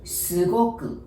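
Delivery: ordinary delivery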